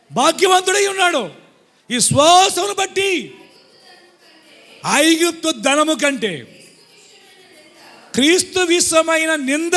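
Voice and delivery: loud, high, slow preaching cry, phrase by phrase; full-force preaching. Real, not acted. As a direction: shout the scripture at the crowd.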